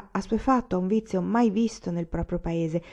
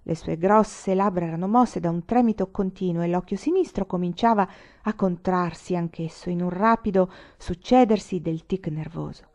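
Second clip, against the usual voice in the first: plain reading voice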